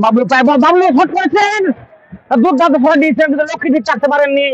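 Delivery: high, loud shout at the shot; frantic football commentary. Real, not acted. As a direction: yell the strike like a goal moment. Shot on goal, live commentary.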